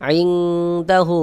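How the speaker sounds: আইনদাহু read slowly, with the ন hidden and nasal before দ; slow Quranic recitation of আইনদাহু with the nun hidden; ikhfa demo. Shown as a demonstration. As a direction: stretch আইনদাহু and hide the nun in the nose. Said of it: "এখানেও আপনাকে গোপন করে পড়তে হবে".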